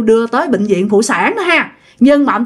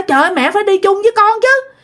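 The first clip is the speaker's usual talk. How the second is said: high, quick hammed-up pleading; mock urging; 8 semitones higher than usual. Husband urging his mother-in-law to come along, high and quick. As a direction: push grandma to come, playing helpless husband. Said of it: "ba nói".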